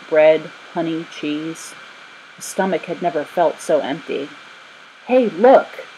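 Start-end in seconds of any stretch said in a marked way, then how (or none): 5.1-5.9 higher, louder excited call; eager shout to look. A narrator's reading. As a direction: grab your friends' attention. Boy calling friends to look.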